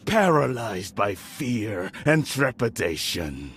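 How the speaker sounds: normal talking voice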